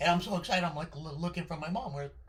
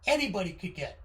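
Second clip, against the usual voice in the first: loud, high, thrilled announcement; excited dad; 6 semitones higher, 10 dB louder than usual. Retold or imitated. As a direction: sell it like the best gift ever. Dad announcing bike to kid.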